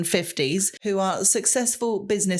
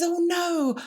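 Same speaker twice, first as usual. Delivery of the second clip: higher-pitched mock concern; concerned teacher; 10 semitones higher than usual. Teacher hearing of a pupil's injury, lightly acted concern. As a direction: lightly imitate a teacher's dismay. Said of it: "And she says".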